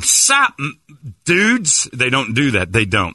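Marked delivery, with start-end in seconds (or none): 0.0-1.9 high, loud American dude caricature; hammed-up American greeting; mock American accent. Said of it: "they're not going".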